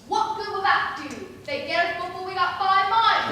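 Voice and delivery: loud, exasperated argument; heated objection. Acted. Accomplice rejecting Pierre's plan to flee, heated objection. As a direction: shoot the plan down loudly.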